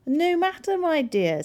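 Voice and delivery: high, loud, airy dismissal; storybook wolf swooping up high; crafty wolf. A narrator's reading. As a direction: brush it aside as a sly wolf. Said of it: "said the wolf".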